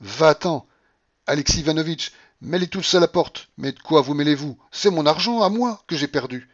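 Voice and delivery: high, loud indignant outburst; furious shouting. A narrator's reading. Babouchka to general and company, furious shouting. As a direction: throw them all out. Enraged woman throws everyone out.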